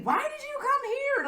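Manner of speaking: high, baffled question; puzzled surprise. Retold or imitated. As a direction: sound truly confused. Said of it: "she was like".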